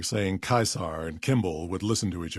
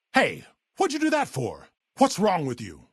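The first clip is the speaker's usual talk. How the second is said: high-pitched, startled outrage; indignant protest; 8 semitones higher than usual. Acted. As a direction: object sharply to being hit. Protesting after being hit by someone.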